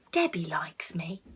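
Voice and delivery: soft whispered thought; hopeful whisper. Acted. The lonely cat comforting herself, soft whisper. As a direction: whisper it to yourself with hope.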